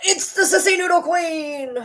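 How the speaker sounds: high, slow cooing at the dog; sing-song baby talk for the dog; high slow coo to greet the dog; doting pet voice. Acted.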